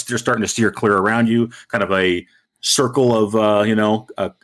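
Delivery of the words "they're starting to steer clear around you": ordinary delivery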